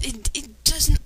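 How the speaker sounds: usual speaking voice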